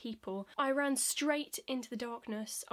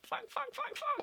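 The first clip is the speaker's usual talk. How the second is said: tiny, very high panicked squeaks; body's alarm; slower than usual. Acted. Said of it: "my body's still going like".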